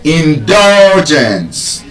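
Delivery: "Indulgence" very slow, DUL held long; penultimate stress. Shown as a demonstration. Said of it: "see if truly this rule works".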